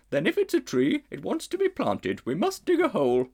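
a grand, ponderous lady elephant; she-elephant. A narrator's reading. She-elephant pondering the strange creature.

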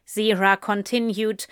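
ordinary delivery

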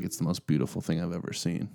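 soft, reverent awe; hushed awe. Retold or imitated. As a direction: breathe it like a revelation. Quotes awed movie character.

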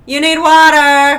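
high, very loud drawn-out yell; intuition shouting. Acted. Her intuition telling her to drink, comic shout. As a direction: holler it comically as an urgent inner voice. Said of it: "my intuition will be like".

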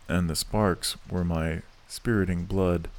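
ordinary delivery, nothing marked

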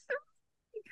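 hidden whisper. Retold or imitated. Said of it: "Dennis Christopher in his hiding place going".

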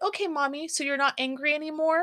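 put-on high, hopeful little-kid question; toddler voice. Mom imitating her toddler asking if she is still angry, high. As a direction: ask it like a small child checking mom's mood.

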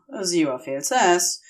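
ordinary delivery, nothing marked

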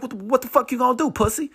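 high, fast mocking challenge; bully's taunt. Bully blocks the teller's way, high taunting challenge. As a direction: imitate a bully daring you to act.

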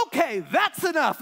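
loud, high exasperated order; stern shout. Retold or imitated. Dad barreling in to stop the bickering, loud exasperated. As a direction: cut them off, you've had it. Father stops kids' fight.

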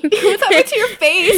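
high, laughing mock horror; laughing tease. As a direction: tease a friend's crying face. Teasing continuation.

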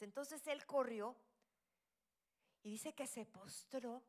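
ordinary talk, nothing marked

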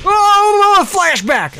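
wail, then settle as it passes; hammed-up flashback. Coming out of a flashback, wailing melodramatically.

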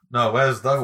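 normal talking voice

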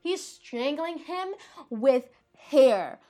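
slow, high, loud stretch on STRANGLING; drawn-out, high-pitched amazement before hair; incredulous emphasis. Real, not acted. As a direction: drag the words out toward HAIR in disbelief. Escalating repeat for effect.

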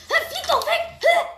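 high, loud laughing yell; excited laughing shout. Real, not acted. Laughing outburst at enemy.

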